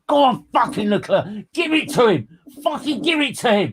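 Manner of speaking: high, loud frenzied cheering at the race; retold fan cheering. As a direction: scream him on like a fan losing it in the stands. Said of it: "these guys can hear me going".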